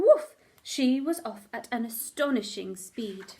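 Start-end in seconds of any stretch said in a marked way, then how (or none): 0.0-0.3 sudden booming woof; loud bark. A narrator's reading. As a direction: burst out with the woof. Dog bursts off.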